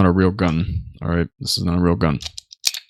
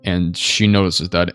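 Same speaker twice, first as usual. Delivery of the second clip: ordinary talk, nothing marked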